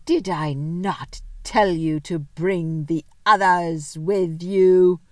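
slow, menacing witch; terrible voice. Acted. The witch scolds Edmund, slow terrible voice. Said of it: "said the witch in a terrible voice".

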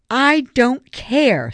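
high, loud, slow and emphatic; heated, defiant dismissal; hot interruption. A narrator's reading. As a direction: cut him off, word by word. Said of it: "Savannah interrupted hotly".

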